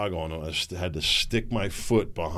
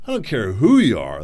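emphatic; 7 dB louder than usual. Real, not acted. Repeats phrase with emphasis.

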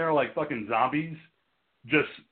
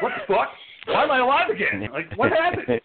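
high, loud burst of disbelief; hammed-up freak-out, pitched way up; panicked confusion; 11 semitones higher, 3 dB louder than usual. Podcaster imagining waking as a vampire, panicked confusion.

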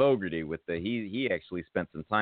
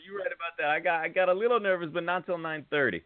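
playful put-on voice; 8 semitones higher than usual. Host joking with guest.

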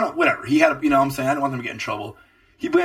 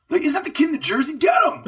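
hammed-up cop shouting get him; mock cop shout; faster than usual. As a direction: play a cop spotting the guy and yelling. Imagining cops spotting him.

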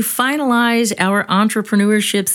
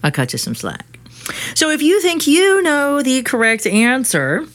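ordinary talk, nothing marked

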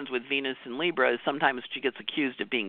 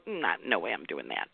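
low, softer aside under the breath; muttered refusal; 9 semitones lower, 8 dB quieter than usual. Retold or imitated. Walking away after a smile, low muttered refusal. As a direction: grumble it to yourself once they're gone. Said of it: "you can leave and go".

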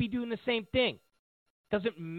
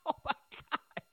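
laughing disbelief; 8 semitones higher, 5 dB quieter than usual. Real, not acted. Laughing at Hillary's concussion claim.

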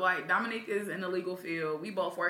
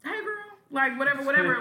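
put-on high, bright "hey girl" greeting; friendly chummy voice; 8 semitones higher, 4 dB louder than usual. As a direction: get cozy and chummy, pitched up. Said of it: "and I'm like".

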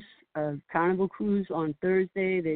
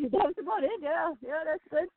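laughing; 8 semitones higher than usual. Real, not acted. Laughing at her own line.

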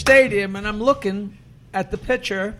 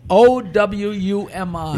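slow, each letter separate; spelling out letters; 5 dB louder, much slower than usual. Shown as a demonstration. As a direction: spell it out clearly for listeners. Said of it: "[name], spell it".

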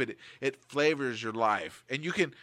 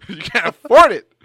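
much louder, higher teasing yell; mock shout; 8 semitones higher, 16 dB louder than usual. Host teasing his co-host, a loud mock yell. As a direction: bark it as a joking put-down.

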